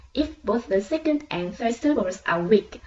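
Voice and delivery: ordinary talk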